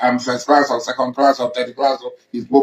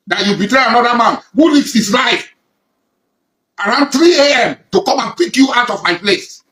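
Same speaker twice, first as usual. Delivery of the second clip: rising indignation; 7 semitones higher, 3 dB louder than usual. Real, not acted. Denouncing betrayal, voice climbing.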